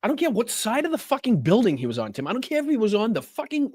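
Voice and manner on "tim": usual speaking voice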